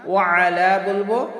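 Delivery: ordinary talk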